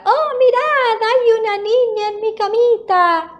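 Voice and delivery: very high-pitched little bear cry; tiny thin shout. A narrator's reading. Little bear spots a girl in his bed, thin high shout. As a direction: squeal in alarm like a small cub. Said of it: "grita con voz finita".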